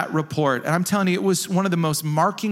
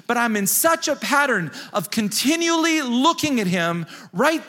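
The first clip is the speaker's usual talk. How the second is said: rising, more fervent preaching voice; 5 semitones higher than usual. Acted. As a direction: preach it with growing fire. Preaches Psalm and praise passionately.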